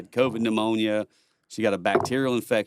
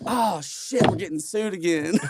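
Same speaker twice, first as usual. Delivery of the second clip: much higher, laughing exasperation; owner's exasperated quote; 8 semitones higher, slower than usual. Retold or imitated. Club owner reacting to the punch, laughing. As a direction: play the owner groaning with a laugh. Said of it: "Jeff's like".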